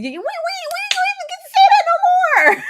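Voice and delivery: high, loud wail through laughter; laughing shout. Real, not acted. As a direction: cry it out while cracking up. Mourning the show's ending, laughing.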